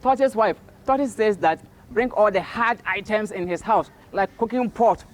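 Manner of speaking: high-pitched messenger voice; bird delivering message. Acted. Bird passes distorted message to Tortoise's wife, high messenger voice.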